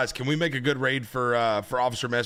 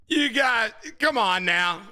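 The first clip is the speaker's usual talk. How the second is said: ordinary delivery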